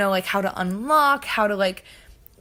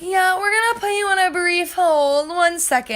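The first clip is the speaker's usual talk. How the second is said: high, sing-out operator putting a caller on hold; mock switchboard voice, high and drawn along; imitated hotline operator; 9 semitones higher, 4 dB louder than usual. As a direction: mimic an unbothered phone operator, pitched way up.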